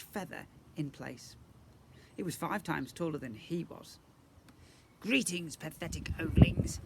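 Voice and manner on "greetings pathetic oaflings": high, squeaky and louder pompous voice; squeaky goblin prince. Acted. Goblin prince greets the family, squeaky and pompous. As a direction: squeak out a smug insult. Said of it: "he said in a squeaky voice".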